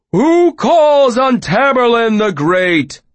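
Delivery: slow, loud, booming wizard; grand intoning. Acted. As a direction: proclaim like a hammy stage wizard. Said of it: "Puppet intoned".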